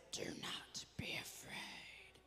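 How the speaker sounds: slow, husky whisper of a caped hero; Batman voice. Shown as a demonstration. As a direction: whisper it like a dark superhero. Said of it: "Say it in your Batman voice".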